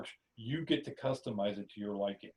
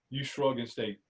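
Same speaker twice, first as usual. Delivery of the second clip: usual speaking voice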